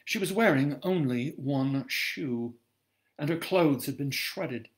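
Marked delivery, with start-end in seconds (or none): none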